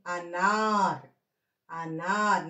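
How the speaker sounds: అనార్ with a drawn-out నా and bare final ర్; slow teacher's model of అనార్, long second syllable; long vowel demo. Shown as a demonstration. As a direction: stretch the aa in అనార్, no vowel after r. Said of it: "నా అని చదవాలి".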